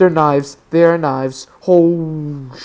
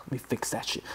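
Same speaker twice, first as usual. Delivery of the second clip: whispering; 5 semitones lower, 17 dB quieter, faster than usual. Real, not acted. Whispered after voice crack.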